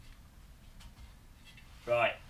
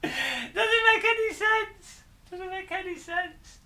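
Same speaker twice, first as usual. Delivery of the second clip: exasperated squeal. Real, not acted. Builder baffled by the instructions, high squealed exasperation. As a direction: stretch it out high in comic despair.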